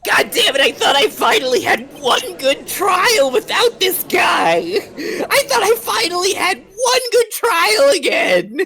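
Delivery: mock rant. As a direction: rage that the lawyer ruined his trial. Prosecutor rants at defense lawyer.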